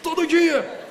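empolgado e mais alto; imitando empolgação. Retold or imitated. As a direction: fala como o rapaz animado. (o visitante animado, mais alto)

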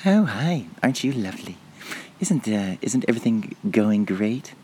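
a lightly voiced sweet old dear; mock sweet pensioner. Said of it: "give them a lovely little smile".

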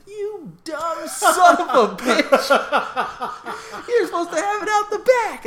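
high, loud mocking dad through laughter; laughing dad. Retelling how his dad laughed at his tie-down, high and laughing.

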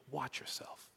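hushed, unvoiced "Watch yourself."; whispered exhortation. Shown as a demonstration. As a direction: breathe "Watch yourself." almost silently. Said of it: "first exhortation".

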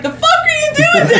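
high, laughing shout; a high laughing shout of disbelief; mock outburst. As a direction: yell it out in disbelief. Quoting Madmartigan's outburst.

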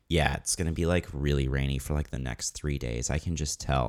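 mock glum certainty. Retelling Colleen's dreary forecast.

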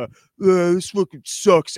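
put-on moody teen. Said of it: "I was kinda more of the".

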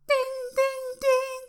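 high sung ding ding ding like a car alarm; car chime imitation. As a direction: mimic the door chime in a high tone.

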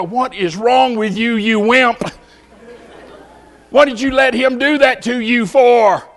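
mock self-scolding, slow, with a whiny near-cry; scolding himself. Preacher mocks himself for backing down, slow, whiny self-scolding. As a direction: berate yourself half-crying, slowly.

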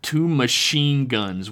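ordinary delivery, nothing marked

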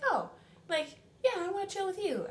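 high creepy charmer imitation. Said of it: "you were like".

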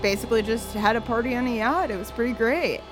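ordinary talk

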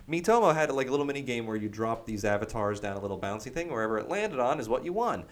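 normal talking voice

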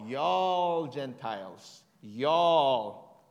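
"y'all" stretched very slow and loud; stress on y'all. Shown as a demonstration. Preacher emphasises the plural word.

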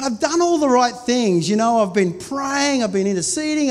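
frustrated prayer retold; frustrated complaint to God. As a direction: vent it like you feel cheated.

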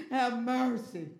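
loud plea, falling at the end; Bartimaeus crying out. Acted. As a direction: call out begging to be heard. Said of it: "Bartimaeus cried out".

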